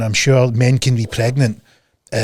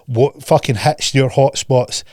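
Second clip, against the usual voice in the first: ordinary talk, nothing marked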